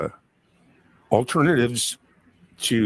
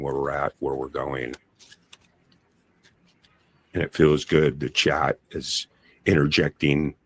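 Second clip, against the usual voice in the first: lower, hushed card reading voice; 10 semitones lower than usual. Acted. Tarot reader laying out cards.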